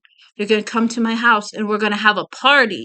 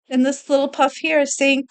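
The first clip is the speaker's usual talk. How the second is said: ordinary delivery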